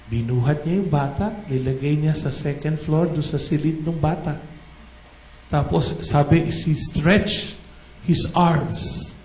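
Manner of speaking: ordinary talk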